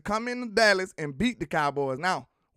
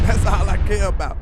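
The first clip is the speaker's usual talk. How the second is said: louder, punchy restatement; loud repeat; 10 dB louder than usual. Real, not acted. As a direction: hammer it home loudly. Repeat after a laugh, louder.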